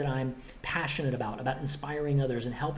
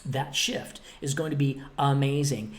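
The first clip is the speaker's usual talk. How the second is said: ordinary talk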